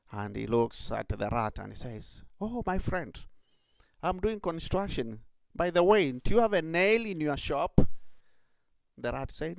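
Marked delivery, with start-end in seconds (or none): 2.4-7.8 higher, louder sly rabbit. Acted. Clever rabbit tricks the rat, high and sly. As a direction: sweet-talk the rat to trap him.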